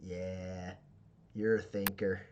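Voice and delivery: a low, quiet appraising boss voice; a hint of a tough boss murmuring approval; boss sizing him up. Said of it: "she goes".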